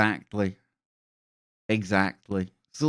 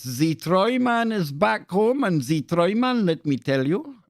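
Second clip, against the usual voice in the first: jiggering and jaggering mode; 7 semitones higher than usual. Pretend. Streamer hamming up a showman intro for his chat. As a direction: crow it like a carnival barker. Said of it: "going into full jiggering and jaggering mode".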